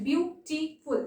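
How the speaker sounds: "Beautiful" split into three short separate beats; "beautiful" with small gaps between syllables. Shown as a demonstration. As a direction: count out "beautiful" as beau, ti, ful. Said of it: "তিনটে word আছে".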